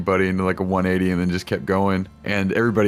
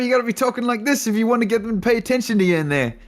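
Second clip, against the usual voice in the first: higher, fast Italian voice; Italian accent; 14 semitones higher, faster than usual. Retold or imitated. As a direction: gesture and talk like an Italian. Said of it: "like Italians do".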